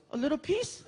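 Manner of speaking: put-on high, soft hopeful child voice; lightly voiced hopeful child; small hopeful kid. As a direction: ask it like a kid who can't believe it. Frankie voiced.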